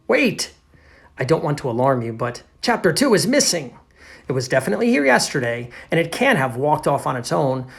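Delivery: loud, animated read-aloud; alarmed story narrator. Acted. Teacher reads to pupils that a chapter is missing, animated alarm. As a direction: read it like breaking bad news.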